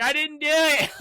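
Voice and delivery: high, mock-panicked wail; imitated panicked protest. [name] protests to the cop, mock panic. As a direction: plead innocence in a hammed-up high voice.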